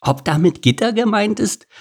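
high, teasing question; joking. A narrator's reading. Meerkat poking fun at the giraffe, joking. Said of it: "witzelte das kleine Erdmännchen".

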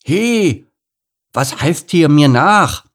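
put-on high, loud childish objection; drawn-out, high-pitched indignation; indignant outburst. A narrator's reading. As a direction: protest like an affronted boy. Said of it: "empörte sich Lukas".

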